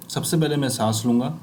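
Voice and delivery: usual speaking voice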